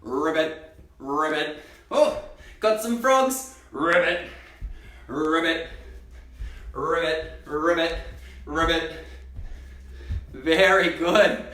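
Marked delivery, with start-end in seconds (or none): 0.0-1.6 lower, playful mock frog croak; frog ribbit. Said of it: "Did I hear somebody say". 3.7-8.9 lower, playful mock frog croak; frog ribbit. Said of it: "Did I hear somebody say".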